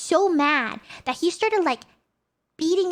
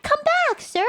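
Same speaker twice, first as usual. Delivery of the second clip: high-pitched mock call; retold yelling; 11 semitones higher than usual. Traveller calling after man who kept walking, high mock yell. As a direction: call it out high, playfully re-enacting. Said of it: "I just kept yelling at him".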